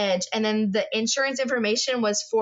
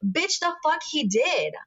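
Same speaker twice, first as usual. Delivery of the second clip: angry retort; 5 semitones higher, slower than usual. Retold or imitated. Angry retold reply to wife.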